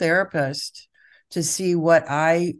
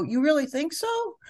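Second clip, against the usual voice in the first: higher, gentle, genuinely curious; gentle curious question; 9 semitones higher, 3 dB quieter than usual. Shown as a demonstration. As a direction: calmly ask the child back. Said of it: "Instead, you go".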